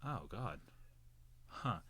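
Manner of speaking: soft, muted response to bad news; numb retold reaction. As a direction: say it quietly, as if it hasn't sunk in. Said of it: "it didn't really register".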